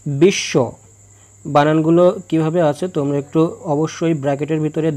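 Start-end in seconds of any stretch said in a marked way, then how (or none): 0.1-0.5 বিশ্ব said as bish-sho, with a doubled sh; বিশ্ব with a long, doubled sh sound; doubled consonant. Shown as a demonstration. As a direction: hold the sh double in বিশ্ব, the ব unsounded. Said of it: "সংযুক্ত বর্ণের উচ্চারণ দ্বিত্ব হয়".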